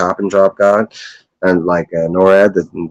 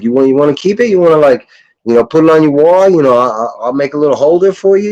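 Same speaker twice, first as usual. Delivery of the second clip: higher, louder eager pitch; eager offer; 7 semitones higher, 4 dB louder than usual. Retold or imitated. Brother eagerly offering to frame the comic, pitched up. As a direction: sell it to him like a gift. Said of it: "I was like".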